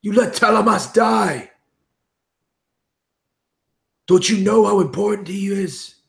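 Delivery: high, loud raging accusation; furious shout. Acted. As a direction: scream the blame at her. Martin rages at Laura Lynn.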